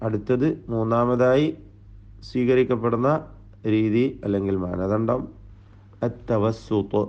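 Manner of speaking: normal talking voice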